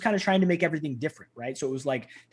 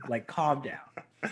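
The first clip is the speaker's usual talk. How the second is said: calm down; 3 semitones lower, 7 dB quieter, much slower than usual. Retold or imitated. Calming the hothead friend.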